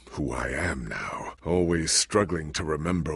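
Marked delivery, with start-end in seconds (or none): 0.1-1.3 slow, unvoiced whisper of doubt. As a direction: breathe the question to yourself. Brooding inner doubt.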